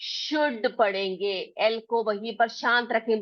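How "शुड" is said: शुड as "shood", no l; silent l. Shown as a demonstration. Teacher showing how to read should, silent l. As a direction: drop the l from शुड. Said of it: "एल जो है वो silent है".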